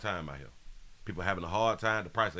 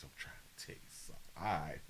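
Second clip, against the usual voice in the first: whisper; 5 semitones lower, 11 dB quieter, much faster than usual. Real, not acted. Whispered aside reading.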